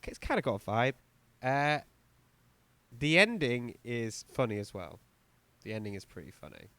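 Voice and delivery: usual speaking voice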